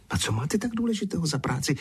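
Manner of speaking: high, fast squeaked question; squeal. Acted. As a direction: squeal it out quickly. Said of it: "vypískla Zuzka".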